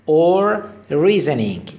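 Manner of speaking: "Or reasoning" drawn out, syllable by syllable; slow demo. Shown as a demonstration. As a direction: spell it out slowly for the class. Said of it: "slow teaching demo". (آهسته و کشیده برای آموزش)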